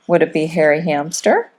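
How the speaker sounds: usual speaking voice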